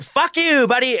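high mock yell at a driver; playful high-pitched curse at a driver; mock road rage. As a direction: fling it at a stranger in traffic, half joking. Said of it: "you could flip somebody off in tra".

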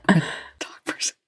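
whispered through giggles; soft breathy laugh on the words. Real, not acted. Giggling aside about cats.

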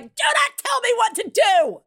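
high, loud mock parent shout; exasperated yell. Host acts out yelling at her own kids, mock furious shout. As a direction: snap back at a bossy kid. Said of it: "there's so many times I'm like".

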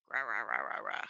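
low creaky rah sounds; vocal fry. Shown as a demonstration. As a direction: gravel the syllables out in fry. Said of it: "vocal fry".

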